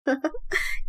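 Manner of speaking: a short breathy giggle, pleased with herself; soft giggle. Acted. Mahasen giggles to her sister, soft giggle. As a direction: giggle quietly, dreamy about the rich groom.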